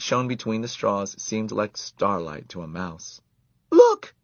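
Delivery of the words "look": high, very loud shriek. A narrator's reading. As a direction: shriek in alarm. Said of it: "shrieked Karen".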